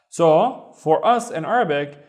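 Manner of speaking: ordinary talk, nothing marked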